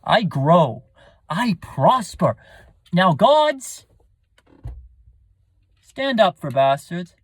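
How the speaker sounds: loud, defiant declamation, a big high cry on gods; defiant villain. Acted. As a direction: spit it at the heavens like a scheming bastard son. Recites Edmund's soliloquy.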